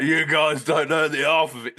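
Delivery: mock aggrieved outburst; Bubsy. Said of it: "Bubsy in the corner".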